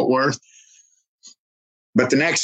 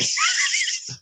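laugh; 5 dB quieter than usual. Real, not acted. Laughs at own joke.

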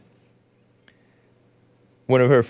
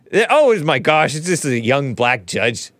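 usual speaking voice

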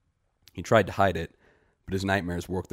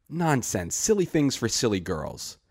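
dismissive scoff; 6 semitones higher, 4 dB louder, slower than usual. Acted. Greet dismisses his accusation.

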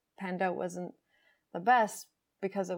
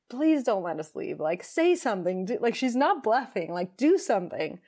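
louder, urgent scared plea; scared pleading; 3 semitones higher, 6 dB louder than usual. Retold or imitated. Frightened self begging the partner to stop her, loud and urgent. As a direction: beg someone to intervene before it's too late. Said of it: "the scared part of me was like".

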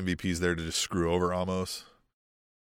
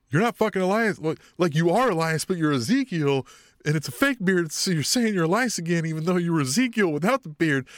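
higher, louder mock rant; exasperated wrestler losing it, voice pitched up; flipping out; 8 semitones higher, 4 dB louder than usual. Podcaster acting out wrestler exposing a fake beard, flipping out. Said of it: "Kevin Owens flips his shit".

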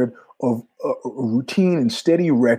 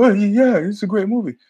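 flustered; 8 semitones higher than usual. Retold or imitated. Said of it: "I go".

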